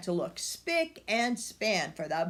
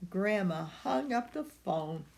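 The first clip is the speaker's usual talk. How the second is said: ordinary delivery, nothing marked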